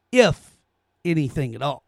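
P=A; pointed repeat. Shown as a demonstration. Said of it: "Did y'all catch those last words?".

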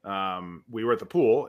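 ordinary delivery

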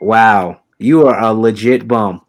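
usual speaking voice

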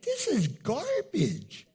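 slow, disgusted mutter at the TV. Retold or imitated. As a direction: scoff at the screen. Disgusted TV viewer.

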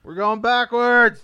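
loud, slow mock alarm; mock panic. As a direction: wail it like the ship is sinking. Mock panic about the game.

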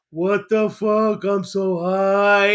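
slow, dazed drugged drawl; slurred high voice. Retold or imitated. Nick Cage character reeling from nerve agent, slow dazed drawl. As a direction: let the words drag like he's high. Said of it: "And his voice is changing".

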